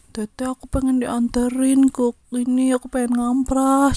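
high, slow, loud wheedle; pleading. Retold or imitated. Student begging an older girl for an escort, drawn-out plea. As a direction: beg with stretched-out words.

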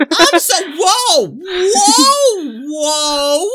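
high, loud shocked whoas. Real, not acted. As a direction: recoil in shock. Shocked reaction to genocide joke.

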